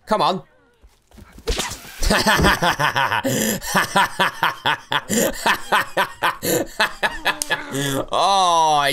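ordinary talk

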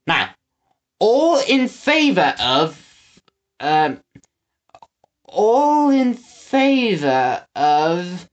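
high, slow, forgetful drawl; trailing lord voice. Acted. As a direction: lose the thread mid-motion. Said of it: "voice trailed off".

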